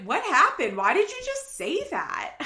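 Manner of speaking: high, loud mock alarm; mock shocked. Host imitating Amber's shocked question, mock alarm. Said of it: "she's like".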